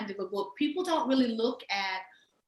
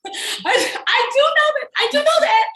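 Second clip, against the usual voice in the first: much higher and louder, insisting through laughter; laughing protest; 10 dB louder than usual. Real, not acted. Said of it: "laughing protest".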